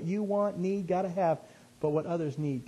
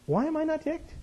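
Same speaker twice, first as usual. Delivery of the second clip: puzzled self-question; 13 semitones higher than usual. Retold or imitated. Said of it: "I'm going".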